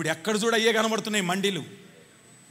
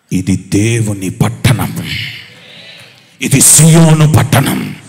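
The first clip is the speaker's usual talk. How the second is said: David's proclamation; 3 semitones higher, 14 dB louder, slower than usual. Acted. David claims the captured city for his God, building proclamation. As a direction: declare the city for God, building to full force. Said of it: "ఇప్పుడు ఏమంటున్నాడో తెలుసా".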